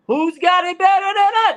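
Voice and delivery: high, loud rallying cry; hollered catchphrase. Acted. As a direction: holler it at the whole room. Imagined coach at podium.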